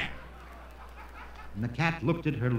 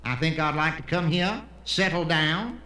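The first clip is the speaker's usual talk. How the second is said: Texan; 3 semitones higher than usual. Acted. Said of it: "the Texan who comes to Israel".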